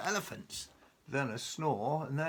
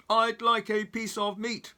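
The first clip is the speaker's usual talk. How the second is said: big booming high voice, much louder; 10 semitones higher, 7 dB louder than usual. Acted. Mister Noisy booms at the butcher, loud. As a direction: boom it out like a giant. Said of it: "boomed Mister Noisy".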